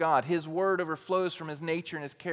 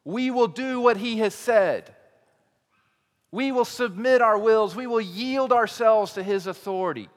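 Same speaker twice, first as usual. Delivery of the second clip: unified loud declaration; 5 semitones higher, 7 dB louder than usual. Acted. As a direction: proclaim it like a whole nation vowing together. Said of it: "that unifying loud voice".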